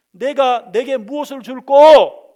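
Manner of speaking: slow, loud, high calling question; God asking. Acted. God asking Solomon what to give him, loud and slow. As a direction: call the question out slowly.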